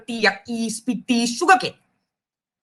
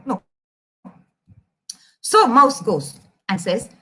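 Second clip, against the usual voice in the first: plain reading voice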